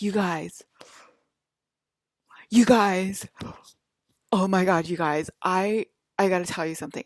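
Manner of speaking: loud, breathless fake-excited gushing, climbing on the repeated you guys; mocking TikTokers who overhype a dream, hammed up; mock gushing influencer. Mocking TikTok posters who breathlessly announce their dream, hammed-up loud gushing. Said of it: "And they're like".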